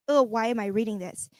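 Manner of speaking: normal talking voice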